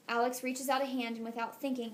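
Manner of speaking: usual speaking voice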